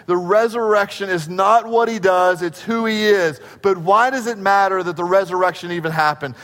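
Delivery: usual speaking voice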